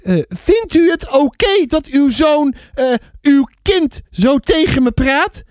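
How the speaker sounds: slow, high-pitched outrage; offended neighbour. Acted. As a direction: demand an answer, slowly and high. Offended neighbour confronts the mother. (verontwaardigd, hoog en traag)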